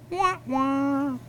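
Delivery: high sung wah wah of failure; mock the lost deal with a sad horn; sad trombone. Rabbi scoring Steve's lost deal, sad trombone.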